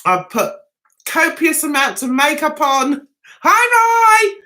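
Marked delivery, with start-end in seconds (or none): 3.4-4.4 high, loud hello to a viewer; cheery greeting. Real, not acted. Host spotting a fan in chat, high and loud. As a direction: call out a bright hi.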